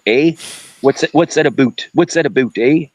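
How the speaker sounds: a hammed-up Canadian accent with "a boot"; mock Canadian accent. Guy teasing a caller about sounding Canadian, mock Canadian accent. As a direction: poke fun at a Canadian accent, eh. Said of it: "you don't talk like that".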